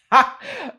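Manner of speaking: a sudden shrieking laugh of disbelief; high-pitched laugh. Real, not acted. Told rocks are the only way up, high disbelieving laugh. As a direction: burst out in a high incredulous laugh. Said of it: "I literally laughed just like that".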